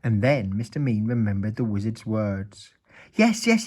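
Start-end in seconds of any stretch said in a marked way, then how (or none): 3.1-3.7 high, loud panicked yes; panicked cry. A narrator's reading. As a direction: blurt it in panic. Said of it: "he cried in panic".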